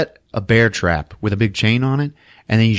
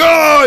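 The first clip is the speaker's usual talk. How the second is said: loud, high mock cry of pain; imitated pained yell; 8 dB louder than usual. Imitates cry of man punched.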